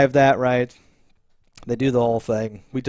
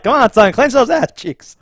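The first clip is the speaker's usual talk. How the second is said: mock ghost dad; 10 semitones higher, 8 dB louder than usual. Ghost dad coaching his son, high and loud. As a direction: egg him on like a pushy father. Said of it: "It's like".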